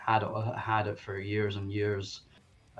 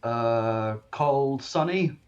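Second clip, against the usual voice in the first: higher, louder halting foreign speech; broken English; 5 semitones higher, 6 dB louder, much slower than usual. Acted. Stranger testing the stand owner's name, in mildly broken English. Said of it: "saying this in kinda, like, broken language".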